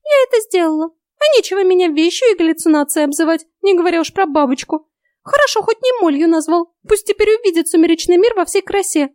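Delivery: high, squeaky fairy voice; piqued, unrepentant little fairy; self-righteous fairy. A narrator's reading. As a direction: justify the revenge in a tiny, huffy voice. Fairy justifies her revenge.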